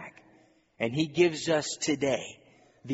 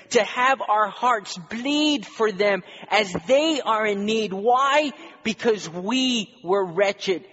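high, loud pulpit exhortation; fiery preaching; 7 semitones higher, 6 dB louder than usual. Real, not acted. Pastor urging the church to love one another, preaching hard. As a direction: drive the call home to the congregation.

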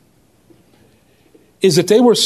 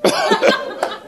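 breathless high laugh; 4 dB quieter than usual. Real, not acted. Laughing at own story.

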